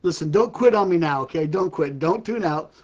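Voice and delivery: ordinary delivery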